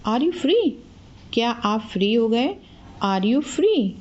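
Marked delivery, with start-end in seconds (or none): none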